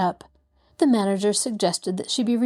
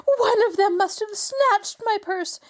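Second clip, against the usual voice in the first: high, strained accusing cry; screaming; 11 semitones higher than usual. A narrator's reading. Mrs Willoughby to the room, screaming accusation. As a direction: shriek the accusation. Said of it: "she screamed".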